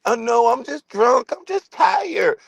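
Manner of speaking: high, slow, put-on woman's voice; slow high mocking girl voice; drunk girl excuse. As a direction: mimic her sleepy drunk whine. Acting out drunk girl's excuse.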